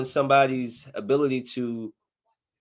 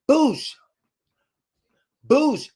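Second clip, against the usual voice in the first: high, loud mock gunshot; shotgun boom; 12 semitones higher, 6 dB louder than usual. Retelling the shootout to the viewers, shotgun boom. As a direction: blast it out like a shotgun.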